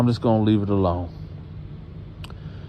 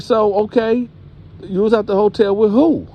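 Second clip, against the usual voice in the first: high, pressing grandma voice; hammed-up interrogating grandma; 10 semitones higher than usual. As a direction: push for the name like a stern grandma.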